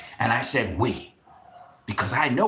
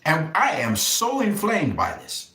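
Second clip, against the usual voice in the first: ordinary talk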